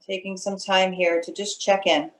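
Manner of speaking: unhurried meditation guide with long pauses; guided meditation. Acted. Meditation leader settling students into their breath, calm guiding voice. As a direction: ease them into stillness, phrase by phrase.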